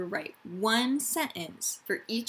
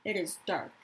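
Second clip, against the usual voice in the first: put-on reading aloud to young children; story narration. A narrator's reading. Teacher reads a refugee story to her class, storytelling voice. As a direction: tell the story gently to the class.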